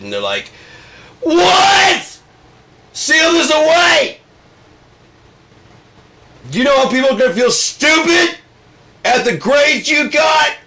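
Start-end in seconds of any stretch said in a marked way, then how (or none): 1.2-10.6 high, loud shrieking shout; panicked experts. Acted. Experts panicking over Trump's grades, high shrieking shout.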